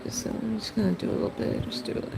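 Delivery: quiet rushed mutter while drawing; mumbling quietly. Real, not acted. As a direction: mumble it under your breath. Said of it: "If I talk like that".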